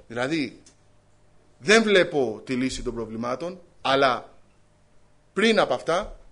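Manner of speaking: loud, rising sermon climax; loud pulpit proclamation, building; fervent preaching. Acted. Preaching at full force.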